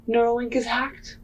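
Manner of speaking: ordinary talk, nothing marked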